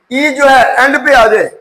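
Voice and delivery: ordinary talk, nothing marked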